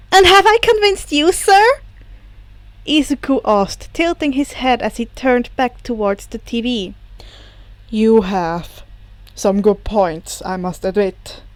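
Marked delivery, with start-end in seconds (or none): 0.1-1.6 high, loud, hopeful asking; eager question. Acted. 7.9-11.4 low, slow grudging admission; grudging villain. Acted. Sensei lower, slow admission.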